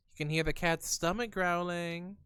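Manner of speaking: ordinary delivery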